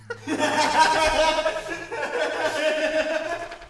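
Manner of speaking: high giggling laugh. Acted. Long laugh.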